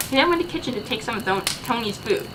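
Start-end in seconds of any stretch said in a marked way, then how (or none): none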